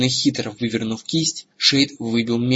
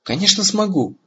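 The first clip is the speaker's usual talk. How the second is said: high-pitched, offhand assurance; careless snort; 9 semitones higher than usual. A narrator's reading. Dragon answering whether she can carry three, careless. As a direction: toss it off dismissively. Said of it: "небрежно фыркнула Сапфира".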